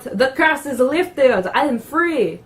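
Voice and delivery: mock French accent, drawn out triumphantly; bad French accent. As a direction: play a freed French ghost in a terrible French accent. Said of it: "That's my horrible French accent for you".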